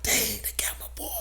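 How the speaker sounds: quick, very soft whisper; barely audible whispered dismay; hushed retold thought. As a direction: whisper it while hiding, trying not to breathe. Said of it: "trying not to breathe".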